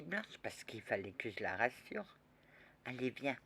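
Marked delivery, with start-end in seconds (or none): none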